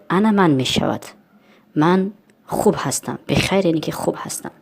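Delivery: normal talking voice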